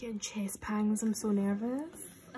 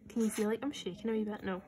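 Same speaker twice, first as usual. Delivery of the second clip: ordinary talk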